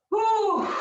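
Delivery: high, loud relieved blow-out breath; loud effortful whew. Real, not acted. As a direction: puff out hard after the effort. Exhale after set release.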